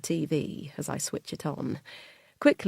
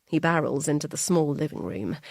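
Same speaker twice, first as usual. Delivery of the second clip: plain reading voice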